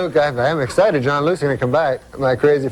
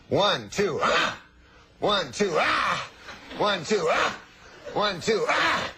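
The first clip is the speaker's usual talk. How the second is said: rising "ah" on each count, climbing higher; counting then crying out higher each time; mock sex grunts; 3 semitones higher, 8 dB quieter, much slower than usual. Neighbor's sex noises through wall.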